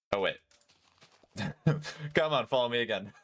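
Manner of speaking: ordinary talk, nothing marked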